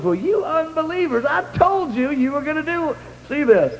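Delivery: high mock-scolding voice imitating Bob. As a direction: tease them as doubting unbelievers, pitched high. Bob teasing unbelieving friends.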